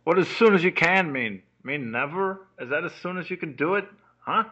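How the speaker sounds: loud, indignant questioning; indignant rant. Acted. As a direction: fire questions back in exasperation. Ranting back at a voicemail greeting.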